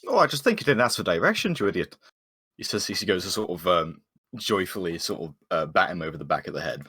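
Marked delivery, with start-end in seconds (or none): 0.1-2.0 lightly acted exasperation, pitched up; higher, teasing in-character rebuke; mild mock scolding. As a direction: playfully tell off a dim companion. Character chides companion in play.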